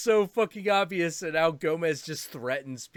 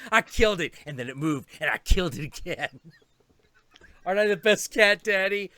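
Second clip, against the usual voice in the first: louder, giddy bragging with a laugh; gleeful cat; 3 semitones higher, 5 dB louder than usual. Retold or imitated. Cat boasting to its owner, gleeful. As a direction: crow about the kill. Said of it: "cat voicing".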